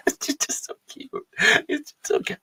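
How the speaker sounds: breathy high whisper over something adorable; cute whisper. Real, not acted. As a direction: whisper it like it's too cute to bear.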